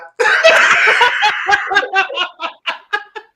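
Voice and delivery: big high burst of laughter; loud laughter. Real, not acted. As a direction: laugh hard at the punchline. Punchline of his own story.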